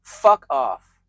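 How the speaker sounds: loud, blunt dismissal; punched, falling brush-off; retold rude rebuff. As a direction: tell them off loudly. Quoted rude reply to a compliment.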